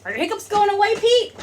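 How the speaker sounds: high, loud, playful asking; playful question. Acted. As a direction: tease the little hiccuper. Asking daughter playing Pete.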